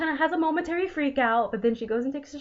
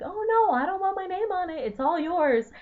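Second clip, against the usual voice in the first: high flustered refusal; 8 semitones higher than usual. Retold or imitated. Kath's quoted reply.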